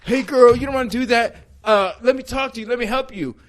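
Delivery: lightly hammed guy talking down a jumper; mock dude hero. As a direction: play the cocky American rescuer.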